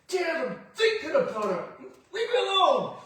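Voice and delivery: put-on high, loud whiny kid voice; little boy snapping at dad from pool edge; annoyed little boy. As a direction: push the dad off like a nervous kid. Scared boy answering dad.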